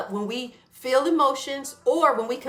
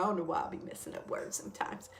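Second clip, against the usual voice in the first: fast, whispered self-reproach; muttered aside; 4 semitones lower, 11 dB quieter, faster than usual. Real, not acted. Correcting herself on a word, under her breath. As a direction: mumble it to yourself, embarrassed.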